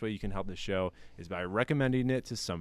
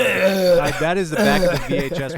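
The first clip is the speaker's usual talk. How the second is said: louder and higher, rushed and thrilled; excited outburst; 9 semitones higher, 14 dB louder than usual. Real, not acted. Host wraps up the blurb reading, in a loud excited rush. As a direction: burst out with delight, quickly.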